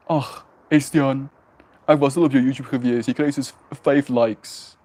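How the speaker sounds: a hint of a pompous dad teasing; mocking dad voice. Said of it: "making fun of his voice on purpose".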